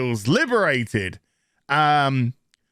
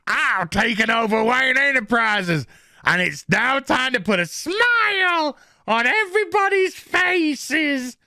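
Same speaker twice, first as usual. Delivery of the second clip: hammed-up higher Joker-style villain voice; 7 semitones higher than usual. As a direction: play the grinning villain, hammed up. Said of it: "and he's just like".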